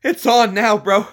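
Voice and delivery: high excited ribbing; excited teasing. Real, not acted. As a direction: gleefully rib your friend. Teasing friend about new name.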